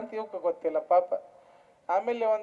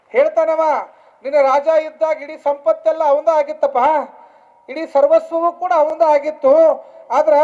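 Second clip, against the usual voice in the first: loud, raised sermon delivery; preaching; 6 semitones higher, 10 dB louder than usual. Real, not acted. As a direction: drive the point home to the crowd. (ಗಟ್ಟಿ ದನಿಯ ಪ್ರವಚನ)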